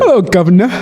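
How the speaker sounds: mock British greeting; British accent. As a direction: throw in a playful British accent. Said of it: "That's a British accent".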